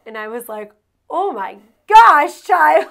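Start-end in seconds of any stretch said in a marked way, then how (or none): 1.1-2.9 high, loud stunned exclamation; overwhelmed gasp. Retold or imitated. Mother floored by her daughter's speech, loud stunned cry. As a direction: blurt it out, floored by your own kid.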